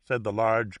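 usual speaking voice

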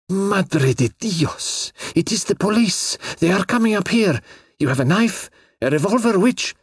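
high-pitched urgent panic; frantic warning that danger is coming; alarmed girl. A narrator's reading. Girl panics as police climb stairs.

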